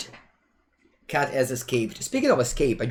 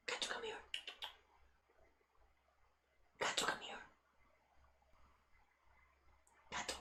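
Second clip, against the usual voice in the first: much softer, off-mic coaxing; calling the cat; 21 dB quieter, faster than usual. Real, not acted. Streamer calls the cat to come here, off-mic. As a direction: call softly to a cat, away from the mic.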